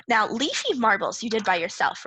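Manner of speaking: ordinary talk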